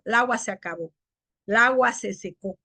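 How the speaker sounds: "El agua" clipped to lagua; article fused. Shown as a demonstration. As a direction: start straight on l in "El agua". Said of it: "hay gente que dice".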